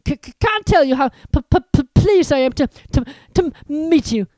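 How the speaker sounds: high, nervous stutter; stammering. Acted. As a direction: stutter out of fear. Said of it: "stammered Professor Quirrell".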